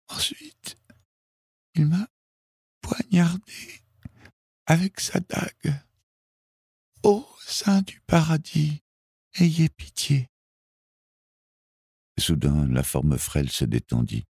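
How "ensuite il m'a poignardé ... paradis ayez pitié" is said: whispered start, then high halting gasps; dying girl pleading with her last strength; very high, broken, breathless delivery; failing, dying voice. A narrator's reading. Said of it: "d'une voix qui faiblissait à chaque instant".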